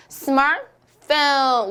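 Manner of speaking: SMARTPHONE said slowly as English "smar-fone", middle T dropped; English pronunciation demo. Shown as a demonstration. Teacher models the word for the class, English pronunciation. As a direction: draw out 스마트폰 in two beats, T left out. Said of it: "어떻게 읽을 수 있죠".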